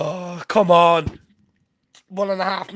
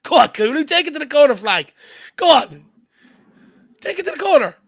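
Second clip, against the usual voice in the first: excited cheering; 9 semitones higher, 5 dB louder than usual. Real, not acted. Fan urging player on.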